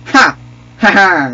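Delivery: high, loud "ha" bursts; gloating laugh. Pretend. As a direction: punch out a victory laugh. Gloats in play.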